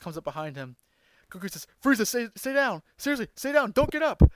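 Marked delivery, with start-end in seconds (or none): 1.8-4.2 urgent warning. Retold or imitated. Goku warning Frieza, acted quote.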